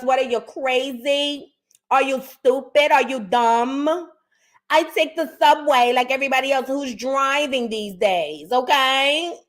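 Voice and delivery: sass it like a New York lady; mock New York lady accent, lightly done; New York accent impression. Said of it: "I know how this lady talk".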